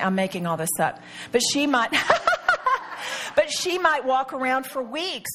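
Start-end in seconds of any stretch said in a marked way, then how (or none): 1.9-3.3 high-pitched burst of laughter; helpless high laugh. Real, not acted. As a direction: break up laughing mid-thought. Breaks up mid-example.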